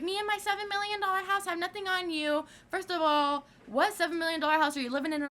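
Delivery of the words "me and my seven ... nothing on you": high snooty mimic of the mom; mocking the rich woman. Teen mocking the rich mom's boast, high snooty voice. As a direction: sneer it up high like a snob. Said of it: "and she was like".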